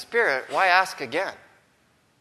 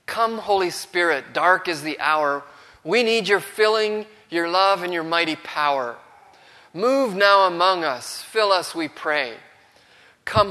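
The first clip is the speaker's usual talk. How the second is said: fervent recited hymn; 2 semitones higher, 5 dB louder than usual. Acted. Preacher reciting a hymn to his congregation, fervent and loud. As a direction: proclaim the hymn like a call to heaven. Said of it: "The songwriter penned those words".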